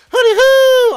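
high, very loud hooting holler; drawn-out comic hoot, far above normal pitch; whooping call. Acted. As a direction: belt it out as a long, high whoop. Calling out a viewer's written whoop.

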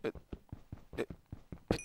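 usual speaking voice

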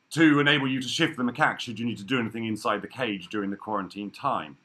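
usual speaking voice

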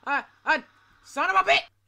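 sudden loud curse after a scare; startled yell. Real, not acted. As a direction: yell it out in shock. Jump scare in game.

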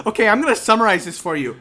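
mock imitation. Teasing a friend about summarizing, quick mocking. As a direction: rattle it off to tease. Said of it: "You're like".

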